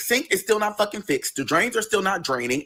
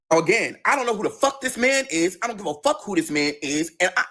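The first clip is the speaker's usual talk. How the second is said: normal talking voice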